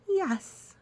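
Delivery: high eager yes; bright enthusiastic agreement; positive. Acted.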